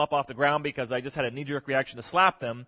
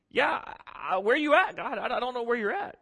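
psalmist questioning God; 8 semitones higher than usual. Acted. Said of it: "he's like".

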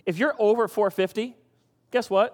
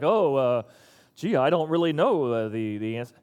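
lower, fumbling dumb voice; mock clueless volunteer; 6 semitones lower than usual.

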